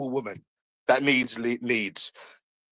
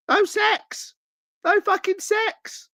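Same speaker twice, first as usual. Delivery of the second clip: mocking squeal, much higher; jeering falsetto taunt; much slower than usual. Real, not acted. Taunting his rival with a high mocking squeal.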